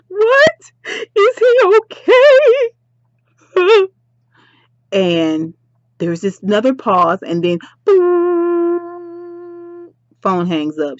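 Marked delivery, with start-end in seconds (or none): 0.1-2.7 loud, very high, stretched tearful wail; shocked cry. Acted. Duchess asks the caller about Caesar, shocked cry. Acts shock at crash news. 7.8-9.9 long, high held droning note; imitated dial tone. Vlogger mimics the phone call ending, imitated tone. Imitates line after pause.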